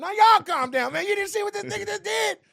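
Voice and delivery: very high, loud mock outburst; acted worked-up protest. Actor told to calm down, worked-up protest. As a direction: holler back in hammed-up disbelief.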